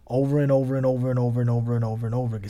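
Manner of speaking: rapid-fire repetition. Real, not acted. Stressing endless repetition of reading.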